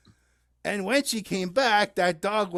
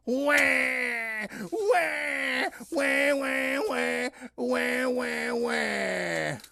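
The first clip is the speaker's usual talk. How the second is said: fake baby wailing; 4 semitones higher, much slower than usual. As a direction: cry like a baby, fading at the end. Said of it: "I'm not really crying. I was just acting".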